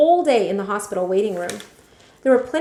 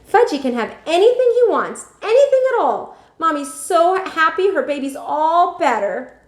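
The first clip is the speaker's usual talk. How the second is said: put-on high, loud baby-talk gush; doting mother; 9 semitones higher, 6 dB louder than usual. Mother to little Fudge, gushing baby talk. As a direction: coo over the baby.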